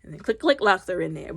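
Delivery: ordinary delivery, nothing marked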